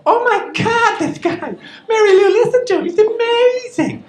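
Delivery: put-on American women swooning over his accent, shrill and gushing. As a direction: squeal like a starstruck fan. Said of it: "They love my accent".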